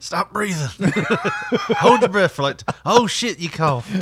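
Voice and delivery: high, loud laughing banter. Pretend. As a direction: crack up while joking. Joking bedroom scene, laughing.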